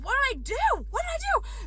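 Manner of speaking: high, squeaky plea of innocence; baffled protest. Retold or imitated. Answering an anonymous hateful text, high baffled squeak.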